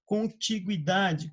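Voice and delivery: "Contiguidade" said very slowly, every syllable clear; slow careful pronunciation. Shown as a demonstration. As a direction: spell out "Contiguidade" by sound. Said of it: "Perceba que a pronúncia não mudou?".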